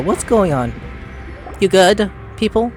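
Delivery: ordinary talk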